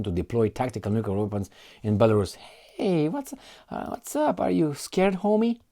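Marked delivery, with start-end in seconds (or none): 2.8-5.6 much higher teasing taunt. Pretend. Taunting Bulgaria after its call for peace, high mock taunt. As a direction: needle them playfully.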